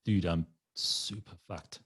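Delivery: slow, effortful retold confession; acting too wasted to talk; laboured effort. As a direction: drag each word out as if barely able to speak. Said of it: "that took all my fucking energy".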